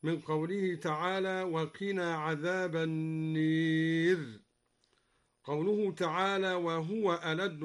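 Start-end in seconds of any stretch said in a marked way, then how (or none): 1.4-4.4 النار stretched slowly as an-neer, a leaned toward e; slow, النار with its long a tilted to e; النار said with imala; imala on the fire. Shown as a demonstration. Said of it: "وكذا أمال قوله تعالى النار".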